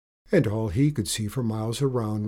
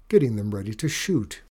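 ordinary delivery, nothing marked

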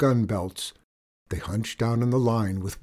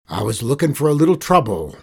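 lightly voiced outlaw, louder and quick; a hint of cowboy bluster; outlaw boss; 3 semitones higher, 7 dB louder, faster than usual. Outlaw boss looking for trouble, mild bluster.

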